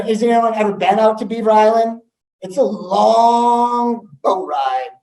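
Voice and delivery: ordinary delivery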